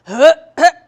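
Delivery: loud mock throat-clear. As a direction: imitate dad noisily clearing his throat. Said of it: "he would always answer the same way".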